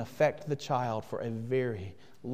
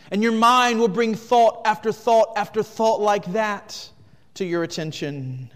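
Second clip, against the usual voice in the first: louder and higher, driving; full-force preaching; 7 semitones higher, 9 dB louder than usual. Acted. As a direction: preach it to the back row.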